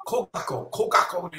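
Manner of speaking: Ko, gako whispered under the breath; mouthing ko, gako quietly to test the sound; muttered to himself. Shown as a demonstration. Testing the name privately, in a near whisper. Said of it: "nah, doesn't sound".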